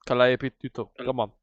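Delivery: put-on Luke's brisk commands in a Russian accent; lightly played Russian-accented guide. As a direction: order the party along in a mock Russian accent. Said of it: "she talked like Russian".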